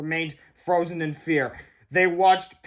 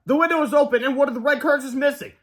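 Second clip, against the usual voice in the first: high, louder urgent report; alarmed discovery; 9 semitones higher, 5 dB louder than usual. A narrator's reading. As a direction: point out trouble excitedly.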